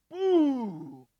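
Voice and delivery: long, loud, high falling "boo"; imitated thunder boom. Preacher imitating the thunder he heard, booming loudly. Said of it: "I heard a big old thunder".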